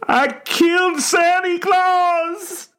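very high, drawn-out scream; wailing shout, all in one breath; tortured sobbing shout. A narrator's reading. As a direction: scream the confession in agony. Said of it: "one tortured breath between sobs".

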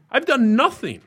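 real exasperation at being accused; indignant. Real, not acted. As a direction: plead your innocence, exasperated. Ranting about unfair treatment.

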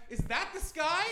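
high, rising innocent question; naive question. Retold or imitated. A stranger in a park asking about the sky, high and innocent. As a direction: ask it like a wide-eyed kid. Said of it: "if you were standing in a park and you went".

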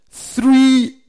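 "Three" called out high and loud; emphatic three. Shown as a demonstration. Preacher stressing the count to students, loud and high. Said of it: "three particles of emphasis".

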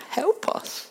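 high, hurt, begging voice; wounded plea to Jesus; pleading disciples. Acted. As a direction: plead like a betrayed child. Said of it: "they basically say to him".

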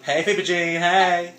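loud, high greeting; excited shout. Real, not acted. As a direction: call out the name. Greeting friends on air.

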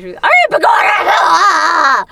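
high, very slow shouted wail; hammed-up Irish pixie cry; Irish flaming pixie. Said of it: "her description of".

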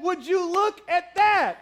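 high, slow put-on voice; sassy exclamation. Pastor replaying his odd reply to his wife, hammed up. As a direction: ham up the surprised drawl. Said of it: "or have I ever said".

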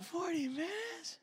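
slow, high, hushed "forty minutes?"; breathless incredulous echo; stunned disbelief. Retold or imitated. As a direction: let the number sink in, aghast. Shocked disbelief at waiting time.

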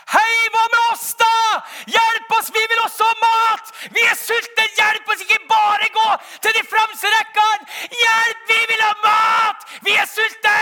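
full-throated yell from far back; very high, very loud cry for help; desperate shouting. Acted. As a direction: scream to be noticed by those up front. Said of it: "hører de noen som roper".

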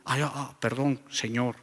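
put-on a scolded kid backing down quietly; meek apology. Scolded youth apologizes meekly. (disculpa tímida, mucho más suave)

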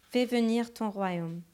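ordinary delivery

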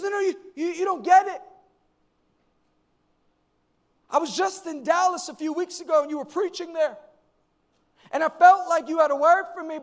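high, excited mock young woman; insistent fan. Young lady explains chasing pastor from Dallas, high insistent imitation. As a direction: plead your case like an overeager fan.

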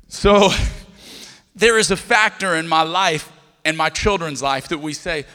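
ordinary talk

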